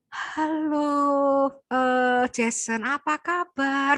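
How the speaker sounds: syrupy, slow sugary greeting; overly sweet. Shown as a demonstration. Teacher mocks fake gentleness with a syrupy greeting. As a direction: lay the sweetness on thick. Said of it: "Bukan yang gitu".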